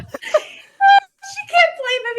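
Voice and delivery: high, drawn-out words through laughter; helpless laughing squeal; real laughing. Real, not acted. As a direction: barely get it out for laughing. Cracking up at the joke.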